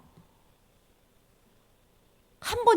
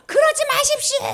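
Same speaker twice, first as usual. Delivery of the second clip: prophet's warning; 13 semitones higher, 8 dB louder than usual. Acted. God warning people through the prophet, high loud cry. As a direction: call it out urgently to a crowd. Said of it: "선지자님 통해서".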